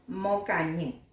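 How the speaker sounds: Mocañi said low and soft with a plain m; soft, low answer Mocañi; plain m in Mocañi, said softly; quiet correct form. Shown as a demonstration. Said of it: "Cuál es el que suena bien".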